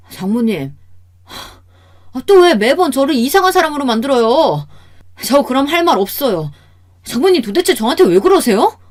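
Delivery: loud, high-pitched exasperated protest; angry outburst. A narrator's reading. Son-in-law before his wife confronting mother-in-law, angry outburst. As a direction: burst out, at the end of your tether. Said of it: "제게 큰 소리로 화를".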